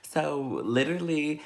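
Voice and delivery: usual speaking voice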